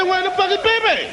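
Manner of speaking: high, exasperated where-is-she question; high pitched outraged question; incredulous yell. Retold or imitated. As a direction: blurt it out in disbelief. Incredulous shout at the woman.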